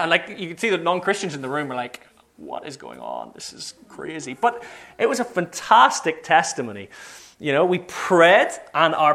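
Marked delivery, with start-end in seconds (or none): 2.4-4.3 very soft, low stunned mutter; retold bewilderment, barely voiced; hushed disbelief. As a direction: imitate onlookers muttering under their breath. Non-Christians' hushed reaction acted out.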